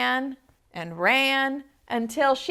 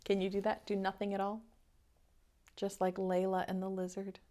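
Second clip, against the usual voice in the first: soft invitation; 9 dB quieter, much faster than usual. Real, not acted. Reader invites child to be still.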